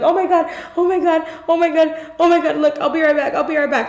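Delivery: high, fast, frantic repetition; mock freak-out. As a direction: imitate someone panicking and pacing the room. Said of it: "she started having a mental breakdown".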